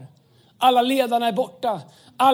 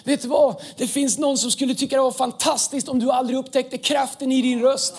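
normal talking voice

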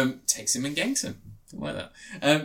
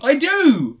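high, loud protest; louder, higher outburst; indignant shout; 13 semitones higher, 10 dB louder than usual. Real, not acted. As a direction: yell it, offended. Protesting he goes to cinema.

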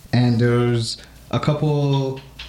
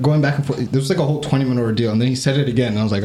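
ordinary talk

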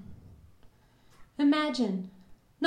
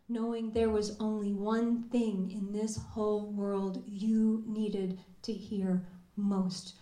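ordinary delivery

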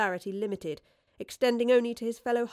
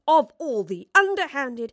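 raised, loud, slow indignation; outraged protest; 6 semitones higher, 9 dB louder than usual. Acted. Woodliffe protesting Fraser's swindle, outraged. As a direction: splutter with indignation at being swindled.

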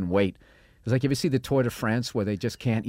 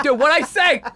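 mock gloating; 7 dB louder than usual. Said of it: "And then bam".

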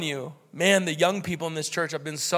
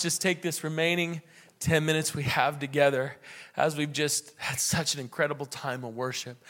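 prayer; 4 semitones lower, 4 dB quieter than usual. Acted. Let's pray.

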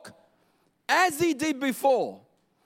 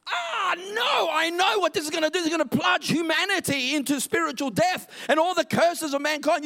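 furious God reacting; 5 semitones higher than usual. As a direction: rage at them as a God who snaps. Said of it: "He could have reacted".

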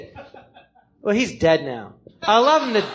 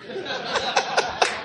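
airy unvoiced chuckle; breathy laugh; 8 semitones higher, 6 dB quieter than usual. Real, not acted. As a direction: laugh it off softly. Laugh at own retort.

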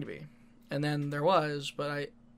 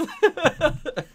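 high laughter; 10 semitones higher, 5 dB louder than usual. Real, not acted. Laughing at the story.

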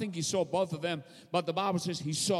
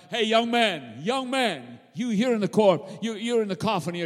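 normal talking voice

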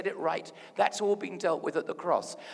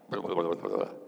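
mock a congregation droning blah-blah; listless run-together blahs; half-hearted mumbling mimicry; 10 semitones lower, 3 dB louder than usual. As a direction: imitate people who don't mean it. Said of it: "You sound like you don't really".

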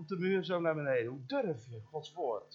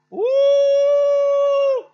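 very high, very loud imitated whoop; shrill ecstatic whoop; 17 dB louder than usual. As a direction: let out her piercing cry. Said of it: "dwars door die zaal heen". (nagedane schelle uitroep)

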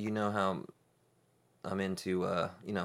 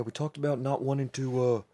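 higher, louder devil character voice; 5 semitones higher, 3 dB louder than usual. Pretend. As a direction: ham up a chatty devil. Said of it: "I'm going straight into the devil voice".

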